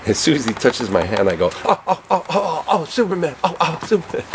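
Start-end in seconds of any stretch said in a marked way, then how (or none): 1.7-4.2 high, laughing fanboy gasps; starstruck gushing. Retold or imitated. Shaking Christopher Reeves' hand, high giddy fanboy gasps. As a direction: gasp like a giddy kid meeting a hero.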